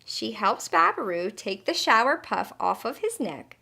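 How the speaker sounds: plain reading voice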